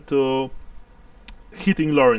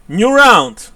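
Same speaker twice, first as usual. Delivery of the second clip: loud, higher ring-out call; announcer's call; 8 semitones higher, 13 dB louder than usual. Real, not acted. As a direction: call out the new round with gusto. Game master announces a new round.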